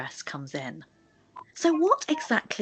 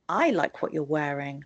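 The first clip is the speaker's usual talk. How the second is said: "I" lifted, the remaining words falling away; stress on I; 3 semitones lower than usual. Shown as a demonstration. Teacher repeating the example, stressing "I". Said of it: "I put the stress at the beginning".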